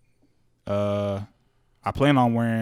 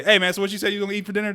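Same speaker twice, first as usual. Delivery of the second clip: higher-pitched brush-off, hurrying to change the subject; feigned casual surprise; 8 semitones higher, much faster than usual. Retold or imitated. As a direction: move it along quickly in a lifted voice. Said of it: "they moved that shit along quick".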